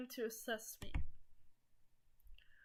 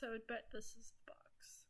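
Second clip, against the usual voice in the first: whispering; 8 dB quieter than usual. Real, not acted. Whispered aside to viewers.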